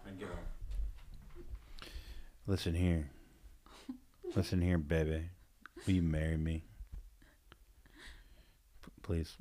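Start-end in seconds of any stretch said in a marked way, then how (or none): 2.5-9.4 smooth suitor. Shown as a demonstration. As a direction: lean in and woo her quietly. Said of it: "This is how I would do it".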